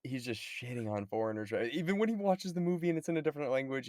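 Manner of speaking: normal talking voice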